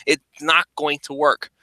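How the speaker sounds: ordinary talk, nothing marked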